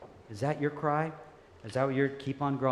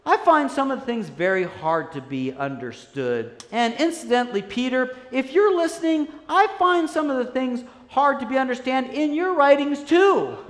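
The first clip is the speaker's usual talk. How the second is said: lightly voiced impassioned letter writer, higher and louder; animated quote; 12 semitones higher, 9 dB louder than usual. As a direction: read the quote like an excited preacher.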